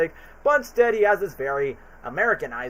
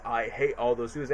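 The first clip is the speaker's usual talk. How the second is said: softly murmured line in a hint of British accent; 6 semitones lower, 7 dB quieter than usual. Shown as a demonstration. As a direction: try the Grinch line the British way. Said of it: "his natural British voice".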